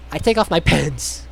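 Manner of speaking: normal talking voice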